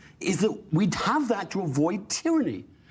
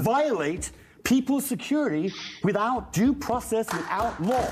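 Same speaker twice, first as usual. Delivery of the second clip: higher-pitched impassioned insistence; passionate; 5 semitones higher than usual. Real, not acted. Panelist defending people's right to due process, passionate. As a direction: drive the principle home with feeling. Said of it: "he's a little passionate about that".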